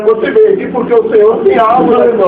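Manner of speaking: loud, fervent address; preaching at full force. Real, not acted. Preacher telling a church member God has something, fervent preaching. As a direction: press the message on the listener with force.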